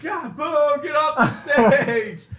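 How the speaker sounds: high, loud heckling, slow ending; loud drunk heckling. Retold or imitated. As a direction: heckle the comic off the stage. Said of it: "and they're just like".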